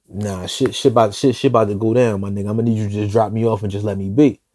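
hushed and ominous, shit about to go down; low grim warning. Retold or imitated. Warning friends quietly in car.

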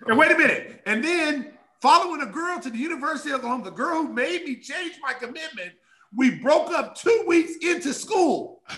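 exclaimed punchline; excited punchline. Real, not acted. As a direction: build to the punchline. Punchline, laughing about breakup.

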